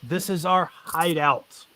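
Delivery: a bandit boss bargaining with the party; bandit leader, wary. Acted. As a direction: warn them off without a fight.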